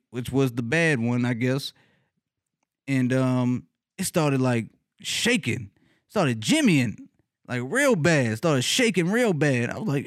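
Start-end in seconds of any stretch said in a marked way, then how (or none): none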